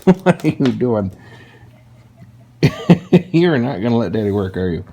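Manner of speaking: talking to a dog. Real, not acted. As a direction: tease the puppy gently. Dog interrupts again.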